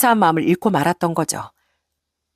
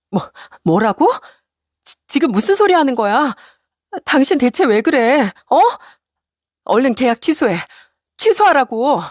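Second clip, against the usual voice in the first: outraged wife; 6 semitones higher than usual. Acted. Wife demands cancel contract.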